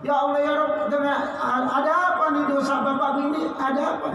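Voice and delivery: high, anguished cry to God; crying out in grief. Retold or imitated. Grieving son cries out to God over his father's body, high anguished wail. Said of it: "terus bagaimana teriak?".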